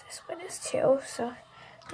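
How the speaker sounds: slow soft whisper. Real, not acted. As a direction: drop to a whisper. Quiet aside while showing slimes.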